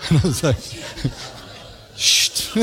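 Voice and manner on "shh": a quick unvoiced hush; hushing shh. Hushing the neighbour.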